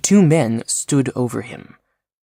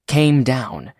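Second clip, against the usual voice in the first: ordinary delivery, nothing marked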